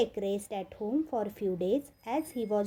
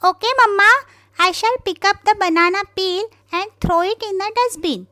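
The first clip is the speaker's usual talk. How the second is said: high, loud, excited promise; eager little rabbit; 10 semitones higher, 12 dB louder, faster than usual. Acted. Little rabbit eagerly promising his mother, high and loud. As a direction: shout it out, proud to obey.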